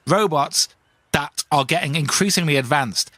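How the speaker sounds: normal talking voice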